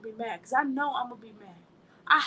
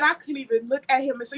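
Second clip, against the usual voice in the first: usual speaking voice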